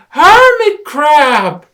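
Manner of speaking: very high, very loud, slow cry; excited exclamation. Acted. Rabbit confirms it is a hermit crab, loud excited cry. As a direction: shout it, certain.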